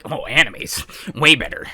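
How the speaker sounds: lightly voiced smug anime purist; a hint of a superior neckbeard sneer. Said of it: "I love it when you do your neckbeard impression".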